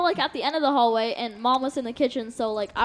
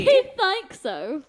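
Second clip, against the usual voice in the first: high, slow put-on British; mock British accent; 6 semitones higher, much slower than usual. Daughter joins mom teasing dad, in high mock British. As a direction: play along in a drawn-out British accent. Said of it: "[name] has this British accent".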